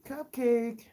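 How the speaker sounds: high-pitched pet call. Real, not acted. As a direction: call a little animal in a high, cooing voice. Calling her pet by name.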